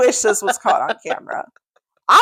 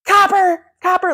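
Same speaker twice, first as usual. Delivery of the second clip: high-pitched calling out; retold dog call; 9 semitones higher than usual. Owner out front to vanished dog, high calling.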